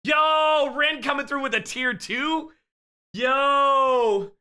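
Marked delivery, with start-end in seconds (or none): none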